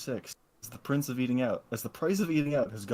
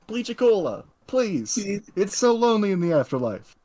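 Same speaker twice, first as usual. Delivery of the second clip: higher and louder, like all caps; all caps shout; 7 semitones higher, 7 dB louder than usual. Shown as a demonstration. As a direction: read it as if it's in all caps. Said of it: "No, I'm sorry, it's all caps".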